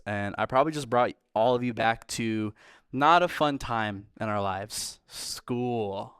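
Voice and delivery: ordinary talk, nothing marked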